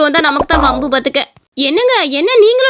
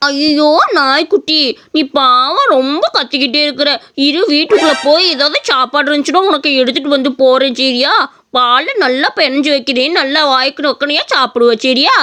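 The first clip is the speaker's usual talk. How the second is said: put-on slow, lower, coaxing baby talk; tender to puppy; 3 semitones lower, slower than usual. Boy lovingly feeds the stray puppy, slow tender coaxing. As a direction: coax the hungry puppy gently.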